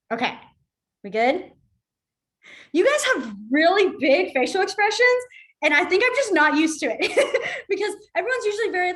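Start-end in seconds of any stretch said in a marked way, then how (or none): 2.7-8.9 laughing. Real, not acted. Amused by students' big expressions.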